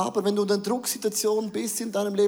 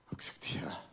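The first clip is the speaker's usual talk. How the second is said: sheepish breathy whisper; a meek whispered yes to the wife; 13 dB quieter, much faster than usual. Retold or imitated. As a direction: caught out, he whispers back to his wife.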